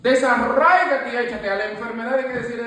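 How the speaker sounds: high, loud preaching, hammering each line; fiery preaching. Acted. Rebuking doubtful talk before the prayer group, loud fiery preaching. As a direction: preach it, driving every phrase home.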